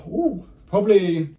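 usual speaking voice

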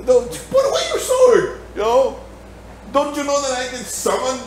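high-pitched mock scolding; acted rebuke. Jesus telling Peter off in the garden, high-pitched rebuke.

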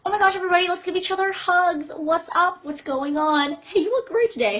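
high, loud, gushing greeting; hammed-up excitement, greeting everybody at once; over-friendly president. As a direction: play an overexcited leader greeting the crowd. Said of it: "I would be like".

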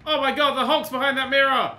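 high, loud shock; alarmed exclamation. Real, not acted. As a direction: cry out as if startled by the screen. Reacts to scare in game.

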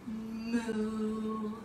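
low, long, drawn-out moo; cow moo. Pretend. Teacher dropping belly like a cow, low moo. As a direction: hold a low humming moo. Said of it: "like a cow and say moo".

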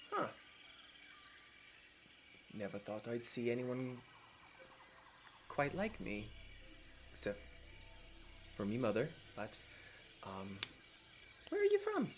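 lightly voiced noble girl, gentle and curious; Emma's voice. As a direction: speak as a poised half-elf princess. GM voices Emma.

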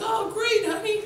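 very high-pitched wife impression; mock delighted wife. Wife hearing bills will be paid, mock delight. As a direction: coo it in a high put-on voice. Wife voiced in retold scene.

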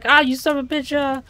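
high, loud cursing yell; hammed-up frightened outburst; mock scream. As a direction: act out swearing at what scared you. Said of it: "I'll scream like".

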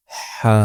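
হা with a breathy throat h and held vowel; drawn-out হা. Shown as a demonstration. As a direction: push হা from mid-throat, hold it briefly. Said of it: "এক আলিফ পরিমাণ টান". (হা এক আলিফ টেনে)

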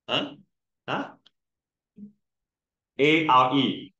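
ordinary talk

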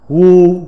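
"gu" read as Mandarin wū, level tone; phát âm tiếng Trung. Shown as a demonstration. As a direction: say "gu" as Chinese wū, held level. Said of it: "Phiên âm tiếng Trung đọc là". ("gu" đọc kiểu tiếng Trung, thanh ngang)

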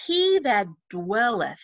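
slow "dwelleth", leaning on its first syllable; emphasis on DWELL. Shown as a demonstration. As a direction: stretch and stress the "dwell" in "dwelleth". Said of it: "put a little line under dwell".

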